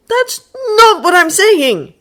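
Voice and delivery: high, slow groaning protest. Acted. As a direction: groan it out, fed up. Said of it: "Draco groaned".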